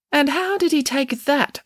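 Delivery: high-pitched, wary question; suspicious. A narrator's reading. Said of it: "asked Judith Piggins suspiciously".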